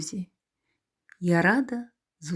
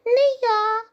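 squeaking mouse; 6 dB louder than usual. Acted. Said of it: "піщить верть".

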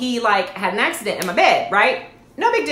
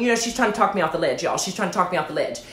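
ordinary delivery

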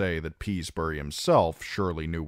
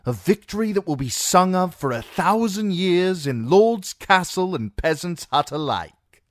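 soldier's voice, much higher and louder; Foxglove, proclaiming; 13 semitones higher, 7 dB louder than usual. A narrator's reading. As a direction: trumpet the prophecy like a herald. Foxglove voiced, grand claim.

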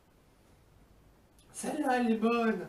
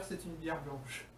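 normal talking voice

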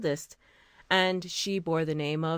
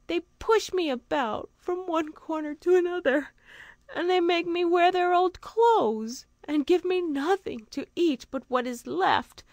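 put-on high, small sad girl's voice; tearful little girl; 10 semitones higher than usual. A narrator's reading. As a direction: voice a hungry, sorrowful young girl. Two Eyes voiced by narrator.

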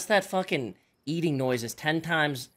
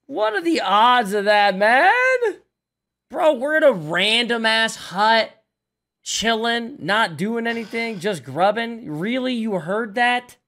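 higher, louder exasperated rant; loud disbelief; 8 semitones higher, 6 dB louder, slower than usual. Real, not acted. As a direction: rant loudly, unable to believe it. Ranting after getting killed.

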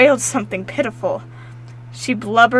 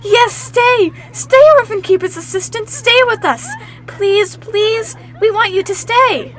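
high, loud, jumping-for-joy cries; children begging her to stay, bursting with joy; excited children; 10 semitones higher, 6 dB louder than usual. Acted. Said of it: "The children danced and jumped".

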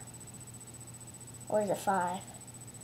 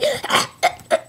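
fake cough; 6 dB louder than usual. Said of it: "I just choked on a little bit of air".

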